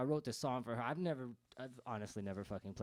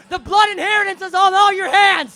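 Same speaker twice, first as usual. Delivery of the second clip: high, loud shout hammered home; screamed again; 25 dB louder than usual. Acted. Singer repeating his blame to the crowd, shouted. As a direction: shout it even harder.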